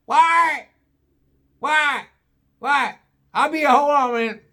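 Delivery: high-pitched hillbilly holler; yelling back. Acted. Junior puts his phone away and calls his brother, high yelling. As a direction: call out to your brother in bursts. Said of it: "As he's yelling Wyatt".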